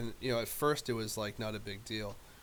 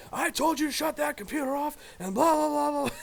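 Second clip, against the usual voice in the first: high, loud mock old-man yell; grandfather yelling; 10 dB louder than usual. Grandfather bursting in to scold the gaming kid, loud mock yell. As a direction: bellow it like a furious grandpa.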